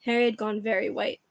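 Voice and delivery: ordinary delivery, nothing marked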